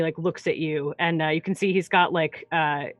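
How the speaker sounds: normal talking voice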